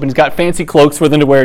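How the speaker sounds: normal talking voice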